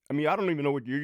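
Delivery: usual speaking voice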